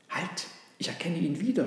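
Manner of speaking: hushed dawning recognition; understated astonishment; amazed. Acted. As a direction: stop short in mild wonder. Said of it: "staunte der Esel".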